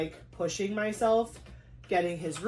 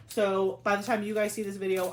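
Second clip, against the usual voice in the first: ordinary talk, nothing marked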